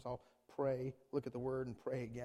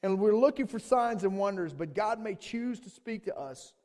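loud, raised sermon voice; forceful preaching; 8 semitones higher, 12 dB louder than usual. Real, not acted. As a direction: preach it out, slowing toward the end.

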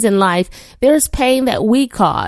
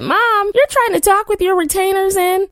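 imitating her son; 10 semitones higher than usual. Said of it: "Caleb said".

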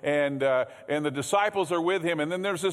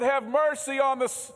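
crying out; 7 semitones higher than usual. Acted. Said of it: "But he cried out".

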